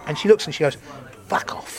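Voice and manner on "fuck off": unvoiced, very soft imitated brush-off; whispered retort. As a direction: whisper it like someone interrupted on a call. Woman on phone rebuffs comedian.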